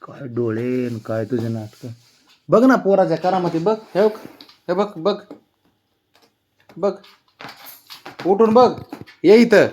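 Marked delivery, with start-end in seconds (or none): 2.5-9.7 high, eager calling out; excited calling. Real, not acted. Owner excitedly calling the pet to come look, high. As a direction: call him over with excitement.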